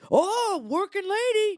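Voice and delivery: high, leering catcaller. Retold or imitated. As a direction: call out to her like a creep on the street.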